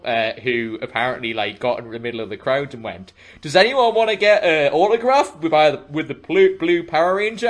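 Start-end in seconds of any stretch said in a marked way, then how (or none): 3.4-7.5 calling out. Retold or imitated. Power Ranger calling to crowd.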